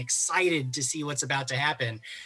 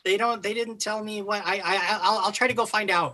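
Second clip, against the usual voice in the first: higher, hurried, stumbling promises; flustered staffer; 7 semitones higher than usual. Acted. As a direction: backpedal nervously under questioning. Flustered staffer cornered by players' questions.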